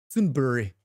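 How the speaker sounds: Sudbury said with a put-on Canadian accent; a playful Canadian accent on Sudbury. Shown as a demonstration. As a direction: try the town name like a Canadian local. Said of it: "How's my Canadian?".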